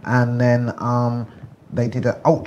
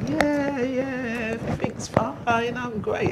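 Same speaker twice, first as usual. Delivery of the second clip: much higher, bright relieved voice imitating a happy stranger; relieved happy woman; 12 semitones higher, 4 dB quieter, much slower than usual. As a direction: gush like a relieved woman with good news. Happy woman leaving the clinic.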